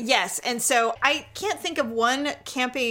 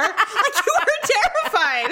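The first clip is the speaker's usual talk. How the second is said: laughing; 9 semitones higher, 4 dB louder, slower than usual. Real, not acted. Laughing while retelling.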